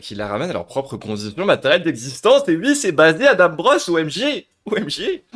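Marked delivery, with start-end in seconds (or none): 1.5-5.2 rising hype, ending in high exclamations; excited outburst. Real, not acted. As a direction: build to a loud, high-pitched exclamation. Gleeful outburst reacting to the video.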